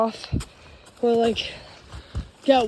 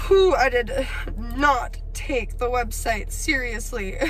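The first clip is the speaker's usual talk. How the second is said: teary; slower than usual. Real, not acted. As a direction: let the tears come through slowly. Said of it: "I feel like I could cry".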